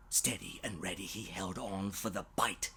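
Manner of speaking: whispered storybook tension; hushed suspense. A narrator's reading. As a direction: hold your breath and tell it quietly. Suspense moment.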